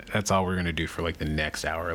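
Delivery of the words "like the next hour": usual speaking voice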